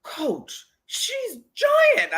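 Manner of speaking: imitated amazement, soaring very high; mock-astonished player, voice climbing into a high squeal. Coach imitating a player seeing the giant opponent's photo, astonished.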